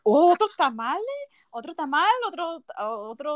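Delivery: mocking relative. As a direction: mimic a judgy relative. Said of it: "don't make those comments like".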